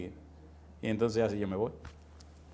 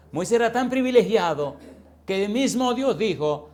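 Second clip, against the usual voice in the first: preaching fervour; 6 semitones higher, 5 dB louder than usual. Real, not acted. Preaching climax.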